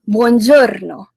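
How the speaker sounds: buongiorno slow, r trilled; rolled r buongiorno. Shown as a demonstration. Host drilling the greeting, slow buongiorno with a rolled r. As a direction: roll the r in buongiorno slowly. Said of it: "once again, it's".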